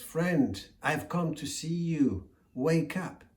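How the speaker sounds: a little tortoise chirping up at a giant. Acted. Tortoise calls out to wake the elephant, high and loud.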